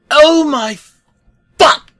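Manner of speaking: very high, loud crying scream; horrified yell. Acted. Truck seeing the severed head, with a very high horrified scream. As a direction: shriek in horror. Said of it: "Truck was yelling".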